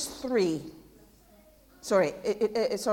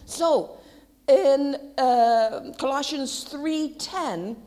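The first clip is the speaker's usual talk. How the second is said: normal talking voice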